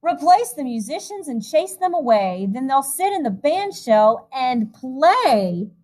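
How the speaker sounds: lilting storybook reading voice; rhythmic, gentle picture-book narration; reading to children. A narrator's reading. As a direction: read the rhyme aloud to a room of kids. Reads a rhyming picture book.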